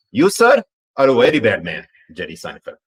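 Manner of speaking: hammed-up Pakistani accent, "sir" jumping high and loud. Shown as a demonstration. Asked by chat, redoing the line in a fuller Pakistani accent. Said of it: "Let me say it one more time".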